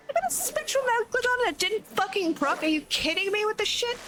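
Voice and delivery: high, exasperated shout at the game; frustrated outburst. Real, not acted. Streamer raging at a proc that failed, high and exasperated. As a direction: rant in disbelief at bad luck.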